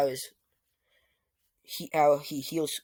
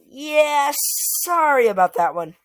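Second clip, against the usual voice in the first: sheepish apology; 9 semitones higher, 9 dB louder than usual. Acted. Iris apologising for cooking too much, loud and sheepish. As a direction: apologize sheepishly but loudly.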